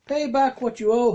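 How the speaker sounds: loud, high demand; angry demand. Acted. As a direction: bark it at the debtor. Servant demanding payment.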